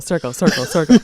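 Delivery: low, looping repeats with a laugh; remix loop imitation. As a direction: echo the word like a skipping track. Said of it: "like, the remix that".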